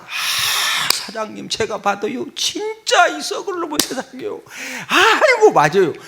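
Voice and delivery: hammed-up flattering hostess, breathy sigh first; agreeing bar hostess. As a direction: play a hostess fawning over a customer. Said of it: "여자들이 그럽니다".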